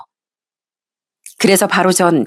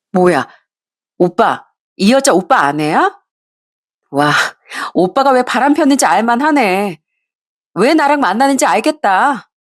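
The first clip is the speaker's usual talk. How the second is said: high, sneering mistress; brazen mockery; 3 semitones higher than usual. Mistress sneering at the wife, high and brazen. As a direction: look down on the wife and taunt her. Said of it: "그 상간녀는 정말 뻔뻔하더라고요".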